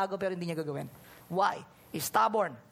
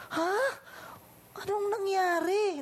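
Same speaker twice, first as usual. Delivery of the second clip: shocked; 11 semitones higher than usual. Retold or imitated. Shocked at news.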